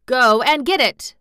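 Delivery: put-on excited young master. A narrator's reading. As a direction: order it in eager haste. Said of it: "digging excitedly".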